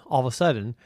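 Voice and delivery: ordinary delivery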